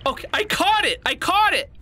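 high excited cry; excited squeal. Real, not acted. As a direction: squeal in triumph. Catches creature.

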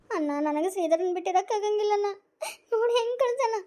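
tearful plea, pitch rising into sobs; crying. Acted. Wife crying to her brother about her husband, sobbing. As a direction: cry out that she cannot live without him. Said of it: "ಈಗ ಕುಂತ್ಕೊಂಡ್ ಅಳಾಕತ್ತ".

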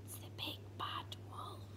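frightened breathy whisper. Acted. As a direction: whisper it in dread. Frightened whisper, contrasts next line.

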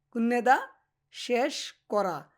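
কুন্নেদা, then শেষ করা drawn out very slowly; slow demo repetition. Shown as a demonstration. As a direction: read it slowly, syllable by syllable, for students. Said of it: "slow model repetition". (শিক্ষকের মতো ধীরে ধীরে উচ্চারণ)

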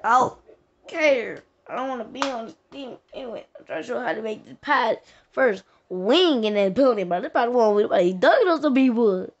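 loud, fed-up rant at the game; frustrated outburst. Real, not acted.

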